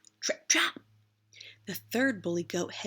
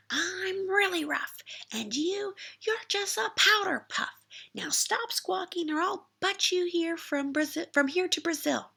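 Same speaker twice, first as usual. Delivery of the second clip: mocking bully goat; 8 semitones higher than usual. A narrator's reading. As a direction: laugh at him then taunt. Medium goat mocks ogre.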